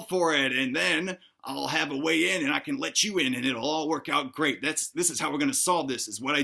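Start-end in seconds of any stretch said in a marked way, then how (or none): none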